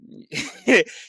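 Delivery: high, loud burst of laughter. Real, not acted. Laughing at being told you sound like an Indian, amused laugh.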